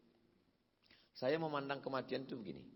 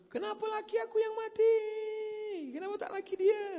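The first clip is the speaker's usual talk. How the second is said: much higher, loud, keening wail; shrill mock lament of a grieving wife; mock wailing widow; 7 dB louder than usual. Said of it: "Meratap itu macam mana?".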